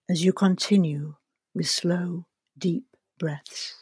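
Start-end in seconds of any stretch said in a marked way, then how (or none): 1.5-3.8 breathy whisper, trailing away; soft spa whisper. Acted. Relaxation breathing cue.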